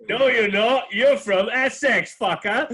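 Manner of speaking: loud, high, laughing heckle; mock heckling retort. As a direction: call him out loudly, slowly, through amusement. Mock retort to bad accent, laughing.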